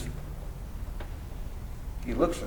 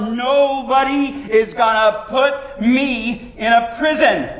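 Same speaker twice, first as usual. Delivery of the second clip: frenzied cry; 5 semitones higher, 5 dB louder than usual. A narrator's reading. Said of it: "he cries out".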